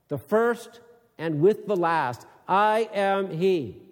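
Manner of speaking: solemn, measured Bible reading; formal reading of the passage; scripture reading. Acted. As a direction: read it out like a proclamation. Scripture reading before sermon.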